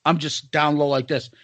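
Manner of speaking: ordinary talk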